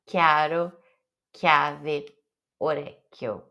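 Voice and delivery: "chiaro" spoken as kya-ro; "chiave" with a crisp k, kya-ve; hard Italian k on each word; hard k sound. Shown as a demonstration. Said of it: "falar com som de que".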